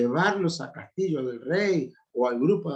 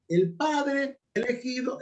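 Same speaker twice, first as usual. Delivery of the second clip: El padre elegido slow and high; slow raised emphasis on El padre elegido; emphatic; 10 semitones higher, slower than usual. Real, not acted. Preacher stressing the point.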